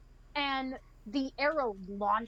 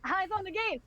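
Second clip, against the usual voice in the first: higher, nervous redirecting; acted alarm, pitched up; urgent warning; 5 semitones higher, faster than usual. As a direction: urge them to look away from you. Voicing nervous man told at bowpoint.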